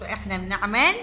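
ordinary delivery

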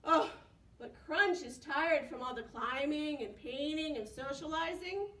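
usual speaking voice

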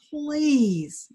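high, loud, falling plea; begging. Acted. Boy begs the boat one last time, high loud plea. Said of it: "Mahmoud begged".

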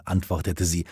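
plain reading voice

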